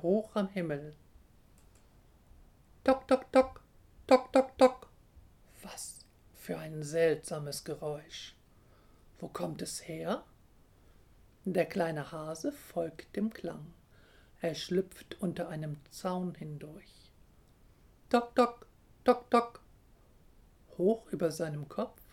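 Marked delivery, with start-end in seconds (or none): 2.8-4.9 high, loud, sharp tocks; knocking sound. A narrator's reading. Woodpecker hammers on the tree, sharp knocking. 18.1-19.6 high, loud pecking tocks; knocking sound. A narrator's reading. Hare follows the woodpecker's noise, sharp knocking.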